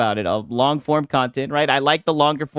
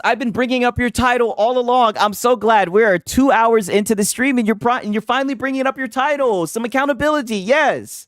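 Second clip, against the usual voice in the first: gleeful teasing; 8 semitones higher than usual. Real, not acted. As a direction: crow over finally getting his point. Gleeful teasing over guest's title.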